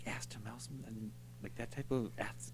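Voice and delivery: hushed whisper under the breath. Real, not acted. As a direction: drop to a sheepish whisper. Embarrassed aside about crude term.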